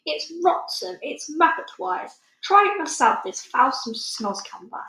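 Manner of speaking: a hint of a giant, grossed out then pushing it on her; lightly voiced disgusted giant. A narrator's reading.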